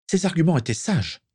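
high-pitched woman's protest; crying out. A narrator's reading. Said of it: "s'écria-t-elle". (exclamation de protestation, voix aiguë)

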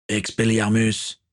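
drawn-out, slow incantation, lightly voiced; slow spell call. Captain Gaël disarming Shiphunt, slow lightly voiced spell. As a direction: stretch the spell word slowly.

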